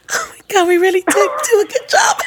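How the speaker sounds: high, laughing pride; proud staff. Retold or imitated. Client voicing the florist's staff pleased with themselves, high and laughing.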